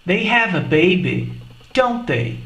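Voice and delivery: "don't they?" falling, asking for confirmation; falling tag intonation. Shown as a demonstration. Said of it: "Percebeu a diferença na entonação".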